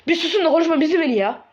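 high, quick outburst; exasperated snapping. Acted. Pupil shushes his teacher on the phone, quick high outburst. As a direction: cut in fast at a high pitch.

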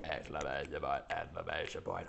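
very low, hushed monotone; deadpan recital. Pretend. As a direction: drop right down and drone it. Reads punctuation aloud as running joke.

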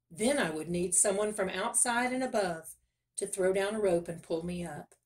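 plain reading voice

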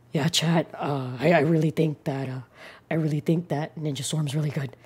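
very low, soft, hesitant streamer voice; Lotus Asakura. Acted. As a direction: drop low and quiet, trailing between phrases. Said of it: "Best Lotus Asakura impression".

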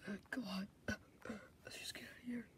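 unvoiced escaping whisper. Sneaking out whispering to his friend. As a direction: whisper it urgently.

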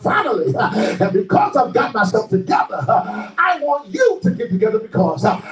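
high, driving sermon cry; fervent preacher with stretched words and gasped breaks; full-force preaching. Real, not acted. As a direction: preach it out in punched, rising phrases.

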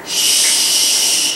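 put-on long shh for a sleeping baby; drawn-out unvoiced shushing; hushing. Shown as a demonstration. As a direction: hush the room gently. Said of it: "The baby's going to sleep".

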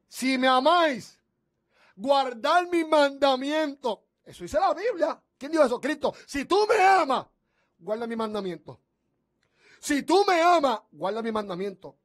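fervent preaching. Acted. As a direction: proclaim scripture to the radio flock. Preacher quotes scripture fervently.

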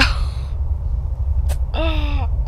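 soft gasp for air; recovering gasp. Real, not acted. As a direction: catch breath after laughing. Catching breath after laughing.

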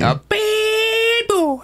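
very high, slow squeal; squeaky falsetto. Acted. Teasing the old man.

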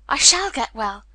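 loud, exultant declaration; cried out. A narrator's reading. Colin in the garden declaring recovery, cried out. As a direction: proclaim it, punching shall. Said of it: "he cried out".

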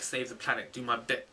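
normal talking voice

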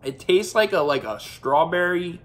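chewing while talking; mouth full. Real, not acted. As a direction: food in mouth. Seen 1: Holds bitten marshmallow at mouth, lifts it to lips mid-talk.

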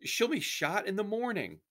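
very high, loud, desperate plea; frantic begging. A narrator's reading. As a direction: plead for a life, pitch soaring. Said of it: "Samira begged".

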